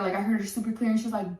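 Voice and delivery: usual speaking voice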